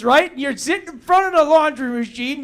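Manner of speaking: ordinary talk, nothing marked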